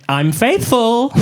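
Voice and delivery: high, very slow guilty announcement; drawn-out, unprompted husband's declaration; suspicious husband. Acted. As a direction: blurt it high, stretching the words. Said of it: "came home one day and just went".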